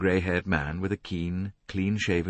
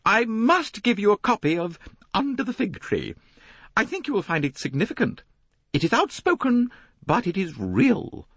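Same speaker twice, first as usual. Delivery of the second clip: much higher and louder, firmly insisting; pressing a gift with conviction; 5 dB louder than usual. A narrator's reading. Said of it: "Missus Otterbourne said firmly".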